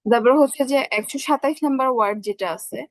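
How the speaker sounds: normal talking voice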